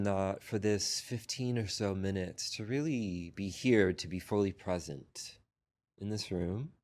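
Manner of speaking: unhurried meditation guidance with long pauses; guided meditation. Real, not acted. Teacher guiding a group into breath and body scan, unhurried meditation voice. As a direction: lead the sitters gently, phrase by phrase, leaving long silences.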